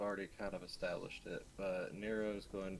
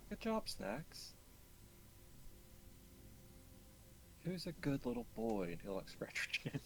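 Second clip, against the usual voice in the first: put-on baby talk to a pet; 10 semitones higher, 3 dB quieter than usual. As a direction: coo at the dog like a baby. Praising the dog.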